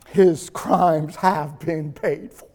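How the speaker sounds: low, soft and slow, utterly calm; calm judge. Acted. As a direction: say it quietly, settled and certain. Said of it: "calmly looks out at the crowd".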